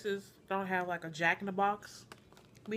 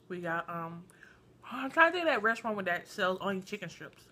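eating between words; talking while chewing. Real, not acted. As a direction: mouth partly full of food. Seen 2: holds sandwich, takes bites at 4.1-7.8, talks between bites.

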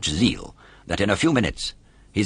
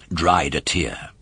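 usual speaking voice

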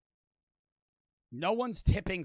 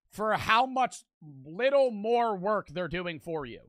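ordinary delivery

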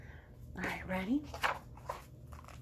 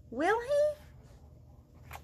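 playful question; 12 semitones higher, 4 dB quieter than usual. Acted. Teasing question to young listeners.

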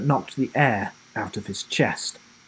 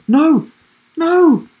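loud high cries, climbing each time; desperate shout; 8 dB louder, much slower than usual. A narrator's reading. Will trapped under the weaving cables, desperate rising shouts. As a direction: shout each one higher than the last. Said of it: "shouted Will".

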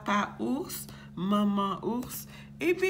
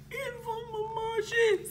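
very high, tearful wail; frightened; 13 semitones higher than usual. Acted. Boucle d'Or fears being eaten, high tearful wail. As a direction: whimper it high, near tears. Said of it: "elle a peur".

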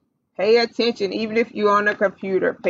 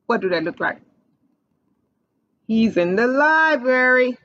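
usual speaking voice

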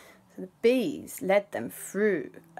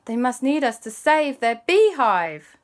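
excited realisation; 6 semitones higher, 7 dB louder than usual. Acted. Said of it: "Alexander and Christopher and Aunt Lisa went".

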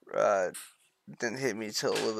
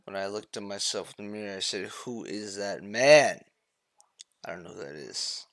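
dramatic monologue; 4 semitones lower, 5 dB louder than usual. Pretend. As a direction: recite it like a solemn confession. Mock-profound monologue.

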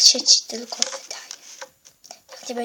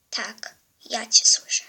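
sinister whisper; 4 semitones lower than usual. Acted. Hidden villain whispers to girl.

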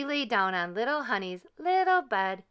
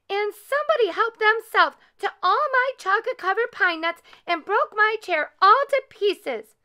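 put-on very high, loud baby bear; loud, high-pitched little bear outcry; little bear squeal; 13 semitones higher, 7 dB louder than usual. A narrator's reading. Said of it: "squealed Little Honey in his little voice".

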